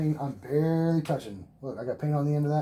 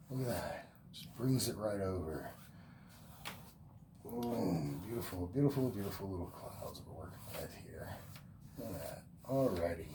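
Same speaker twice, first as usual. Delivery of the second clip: soft admiring murmur; 7 semitones lower, 12 dB quieter than usual. Real, not acted. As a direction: lower and much softer, savoring it. Admiring the clouds softly.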